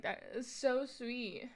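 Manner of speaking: usual speaking voice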